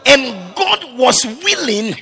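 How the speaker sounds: loud, high, rising preacher's cry; fiery sermon climax; preaching at full force. Real, not acted. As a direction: preach it out, building to a shout.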